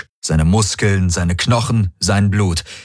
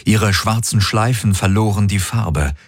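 ordinary delivery

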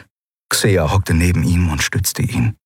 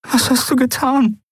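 tearful. Acted. Endriel crying over dying Miko.

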